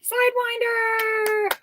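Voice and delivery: high, long-held cheering call; drawn-out call. Real, not acted. Co-host hailing the crew watching from Sidewinder, long high call. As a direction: stretch it out high like a shout-out. Calling out the crew's location.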